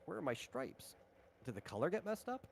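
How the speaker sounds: ordinary delivery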